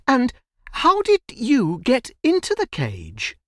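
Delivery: put-on high-pitched child's question; curious little girl. A narrator's reading. Little girl questions the tiger, curious. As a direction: ask innocently in a high voice.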